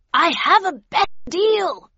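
high, loud shouted offer; yelled. A narrator's reading. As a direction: yell it across the yard. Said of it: "yelled Havisham".

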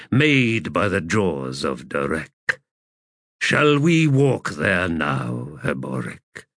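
low, slow, weary god voice; sleeping old god. Acted. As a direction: speak as a drowsy ancient god. Elder god invites.

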